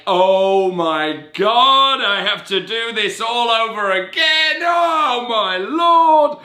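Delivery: mock despair at starting the year over; panicked inner voice. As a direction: wail it like it's all going to collapse. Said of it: "that used to be my default setting".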